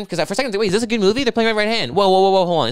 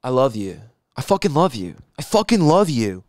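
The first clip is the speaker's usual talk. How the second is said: sappy love declaration; 4 semitones lower than usual. Retold or imitated. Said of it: "like a dumb bitch".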